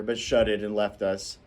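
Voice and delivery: ordinary delivery, nothing marked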